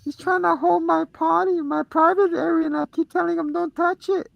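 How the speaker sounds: put-on high, excited little kid voice; squeaky child insisting; little boy voice. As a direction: play a small boy tattling to mom.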